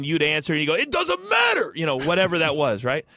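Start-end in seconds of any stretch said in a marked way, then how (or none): 0.7-1.7 high shouted catchphrase, imitating The Rock; mock wrestling-promo yell. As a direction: belt it out like The Rock's catchphrase. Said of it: "he used to do this thing where he would ask you a question".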